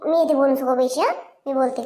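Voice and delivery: ordinary talk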